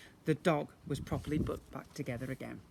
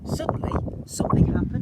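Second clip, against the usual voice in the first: louder, higher sudden turn; dramatic build; 9 semitones higher, 9 dB louder than usual. A narrator's reading. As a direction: announce the surprise.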